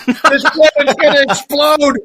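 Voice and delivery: urging wife to flee the planet, shouted in character voice; character voice slipping out. Retold or imitated. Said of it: "And I say to my wife".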